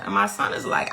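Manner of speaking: normal talking voice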